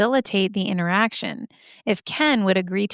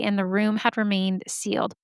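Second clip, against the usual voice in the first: ordinary delivery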